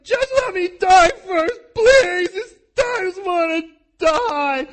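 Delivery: high, loud, slow sobbing wail; wailing plea. Retold or imitated. Hagar begging to die in the desert, high sobbing wail. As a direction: wail it out like a dying woman begging.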